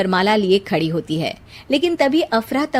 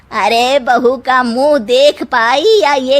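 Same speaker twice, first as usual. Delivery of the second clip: sarcastic aunt; 2 semitones higher, 5 dB louder than usual. A narrator's reading. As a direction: drag out the taunt, then sweep off. Aunt taunts, leaves.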